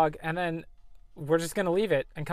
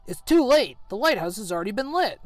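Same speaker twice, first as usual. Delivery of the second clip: higher, louder dramatic hero line; voicing Garrett; 5 semitones higher, 4 dB louder than usual. Garrett warning the party, in a higher dramatic voice. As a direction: play the young hero breaking bad news. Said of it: "turns around to everybody else and says".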